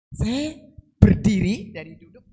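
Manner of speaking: high, loud sudden rise; excited burst. Retold or imitated. Preacher recalling standing up, high loud excitement. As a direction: leap up in the telling. Jumping up in retelling.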